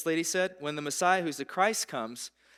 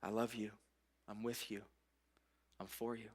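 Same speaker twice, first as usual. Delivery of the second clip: much softer, hushed and tender; soft whisper of Jesus; 4 semitones lower, 10 dB quieter than usual. Acted. Jesus speaking through the door to a seeker, hushed and tender. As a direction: lean close and murmur it through a crack. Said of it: "I'll just whisper through the crack".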